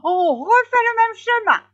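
very high, loud outburst; frantic hare's cry. A narrator's reading. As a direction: shout it beside yourself with agitation. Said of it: "la passion mettait hors de lui, s'écria". (cri du lièvre hors de lui, très aigu)

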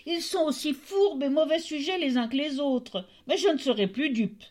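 railing at his lying sons; angry tailor. Acted. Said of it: "s'écria le tailleur". (tailleur indigné, voix montée)

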